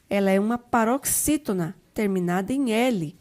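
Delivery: ordinary talk, nothing marked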